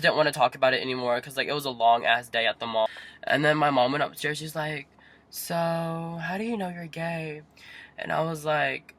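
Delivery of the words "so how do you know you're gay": slow, softer questioning mom voice; imitated mom. Mom questioning child after coming out, imitated mom. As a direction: mimic a mom probing, drawn out.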